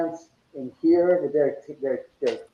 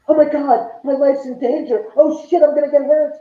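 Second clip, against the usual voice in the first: "Oh my God, my life's in danger" shrieked much higher and louder; mock panic; 9 semitones higher, 6 dB louder than usual. Homeowner mocking ghost hunters who panic, shrill mock terror. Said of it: "It's like".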